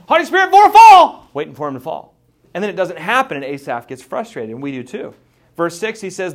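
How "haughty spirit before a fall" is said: very loud, high and fast shout; gleeful shout. Retold or imitated. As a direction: yell it out in glee at the fall. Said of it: "And Asaph's like".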